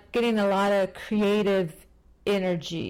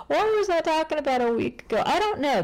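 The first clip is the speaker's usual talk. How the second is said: high-pitched, mock-baffled self-quote; baffled self-mockery; 9 semitones higher than usual. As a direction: raise the pitch, puzzling at your own words. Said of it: "I'm like".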